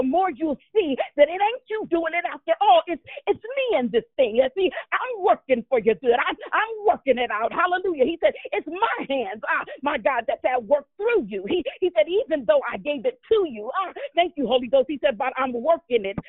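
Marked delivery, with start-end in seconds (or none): none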